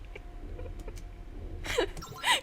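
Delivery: high, breathless unvoiced laugh; high rising breathy laugh; wheezing laugh. Real, not acted. Laughs at the lonely fact.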